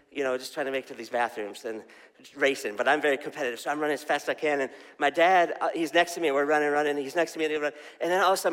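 ordinary talk

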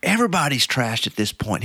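high, upbeat dude reassurance; lit-up voice. Retold or imitated. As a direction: brighten up and cheer him on. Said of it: "the guy's tone and his voice just lit up".

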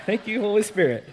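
real laugh breaking through the words; giggling at an unplanned joke. Real, not acted. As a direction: chuckle out the thanks, amused at yourself. Laughing at his own joke.

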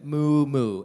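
loud, drawn-out mooing; imitated cow moo. Father quizzing his son on animal sounds, imitated moo. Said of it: "make a series of noises".

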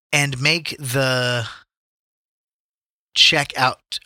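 halting slow read. Shown as a demonstration. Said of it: "Oh, it says".

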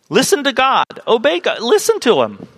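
high, pressing plea; urgent captain voice. Retold or imitated. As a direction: beg the prophet to listen. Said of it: "who's telling Jonah".

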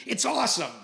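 high, loud mock-cheerful sarcasm; sarcastic it's awesome. Telling his wife on the phone how camp is going, mock cheerful. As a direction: brag about the injury as if it's great, laughing it off.

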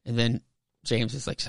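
ordinary talk, nothing marked

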